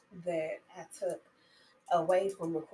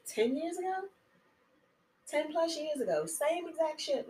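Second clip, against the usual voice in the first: high, loud and indignant; indignant outburst; 10 semitones higher, 4 dB louder than usual. Real, not acted. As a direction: raise it in disbelief. Upset about New Orleans disaster.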